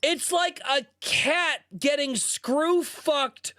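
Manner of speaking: slow, high-pitched fed-up rant; exasperated high whine. Real, not acted. Ranting about annoying anime voices.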